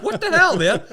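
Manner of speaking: loud high outraged yell; shouting. Retold or imitated. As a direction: yell it in disbelief. Retold shout at flag thief.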